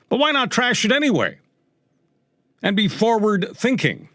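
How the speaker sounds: high, mocking cheer for change; sarcastic mimicry. Radio host mocking those who would trash tradition, sarcastic mimicry. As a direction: pitch it way up, parroting the reformers.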